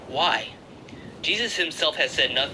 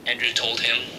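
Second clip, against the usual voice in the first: usual speaking voice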